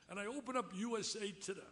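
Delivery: ordinary talk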